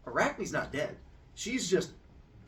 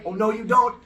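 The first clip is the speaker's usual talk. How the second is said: higher, louder mock defiance; defiant challenge; 7 semitones higher, 11 dB louder than usual. Storyteller voices the challengers facing Athena, defiant challenge. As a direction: call out a rival with relish. Rivals confronting Athena in retold myth.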